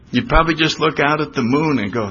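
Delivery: usual speaking voice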